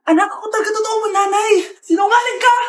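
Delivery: fast, high accusing shout; angry shout. Acted. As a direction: fling the accusation at her rival. Mothers accuse each other. (galit na sigaw)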